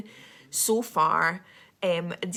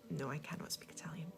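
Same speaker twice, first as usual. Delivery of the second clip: breathy whisper, sharing a secret; whispered confession; whispered aside; 4 semitones lower, 15 dB quieter than usual. Real, not acted. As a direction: drop to a whisper, a little sheepish.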